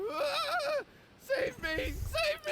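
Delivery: very high desperate cry; begging wildly for rescue; panicked plea. Acted. Client screams for rescue as spirit charges.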